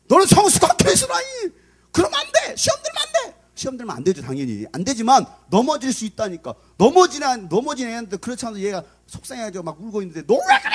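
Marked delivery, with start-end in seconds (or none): 0.1-3.4 high-pitched hammed-up nagging; mock admonishing. Church member lecturing a new believer, high mock nagging. Mimics demanding believer at newcomer. 10.3-10.7 very high, loud outburst; mock scolding. Parent at a crying fallen child, high mock scolding.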